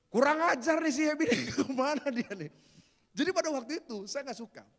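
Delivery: full-voiced preaching. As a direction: preach it to the congregation.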